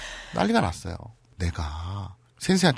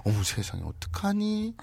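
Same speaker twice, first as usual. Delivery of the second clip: hushed shock; 6 semitones higher, 9 dB quieter than usual. Acted. Acting out stunned listener.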